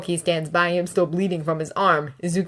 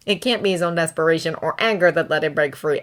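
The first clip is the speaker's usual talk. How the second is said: ordinary delivery, nothing marked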